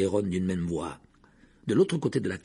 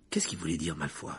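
lower, soft worried murmur; 6 semitones lower, 7 dB quieter than usual. Acted. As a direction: murmur it at the sink. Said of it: "murmura Harry". (murmure inquiet)

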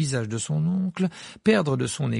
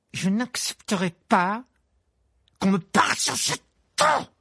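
trembling with rage; 9 semitones higher, 6 dB louder than usual. A narrator's reading. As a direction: explode on ton. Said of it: "frémissant de rage".

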